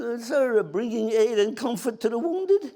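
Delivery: high, laughing nervous excuse; nervous stammer. Retold or imitated. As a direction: stammer like a caught private. Private stammering excuse to major.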